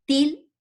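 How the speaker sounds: "Til" alone, high and loud; isolated syllable. Shown as a demonstration. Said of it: "es la otra sílaba". (la sílaba "Til" aislada, fuerte y aguda)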